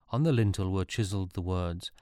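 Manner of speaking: ordinary delivery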